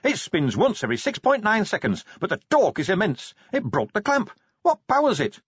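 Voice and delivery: high, rushing with amazement; excited inventor Pony. Acted. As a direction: gush about the machine.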